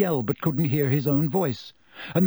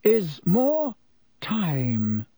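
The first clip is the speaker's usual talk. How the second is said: a pause before time; slow, pointed pause; measured and slow; slowly; 4 semitones higher, much slower than usual. A narrator's reading. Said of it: "said Dumbledore slowly".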